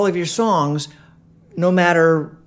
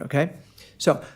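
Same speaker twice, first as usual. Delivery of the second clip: ordinary talk, nothing marked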